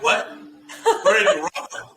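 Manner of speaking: high, loud incredulous cry with a laugh; shocked disbelief. Retold or imitated. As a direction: play your own shock at bad news, half laughing. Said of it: "and I'm like".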